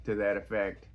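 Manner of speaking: ordinary talk, nothing marked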